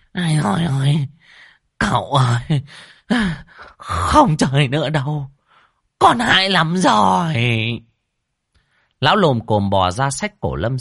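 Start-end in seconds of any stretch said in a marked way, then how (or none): 0.1-7.3 wailing yell. Acted. Old medium comes to and begs out, wailing yell. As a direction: wail at the top of your voice. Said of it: "Lão đồng tỉnh dậy gào lên".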